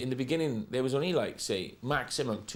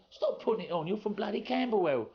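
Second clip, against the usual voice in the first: high-pitched mock-indignant retort; exasperated girl, imitated; 10 semitones higher than usual. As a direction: mimic her scornful disbelief, voice climbing high. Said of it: "she says".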